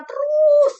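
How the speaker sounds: a sudden loud high cry of terus; preacher's fervent shout. Real, not acted. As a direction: belt the word out high. Preaching climax.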